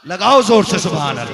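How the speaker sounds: rousing loud call for response. Shown as a demonstration. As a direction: shout it out to the crowd. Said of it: "इतनी जोर से सुभान अल्लाह कहना". (ज़ोर से पुकारना)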